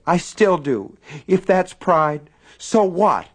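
high, loud and slow defiance; defiant. A narrator's reading. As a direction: challenge him openly. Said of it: "defiant face".

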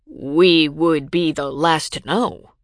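slow and deliberate, stunned disbelief; slowly. A narrator's reading. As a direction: draw each word out slowly. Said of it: "Robin said slowly".

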